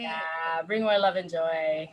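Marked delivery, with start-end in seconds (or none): none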